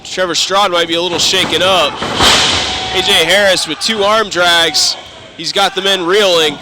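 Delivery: loud play-by-play call; wrestling commentator. Acted. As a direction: sell the arm drags to the crowd. Live match play-by-play.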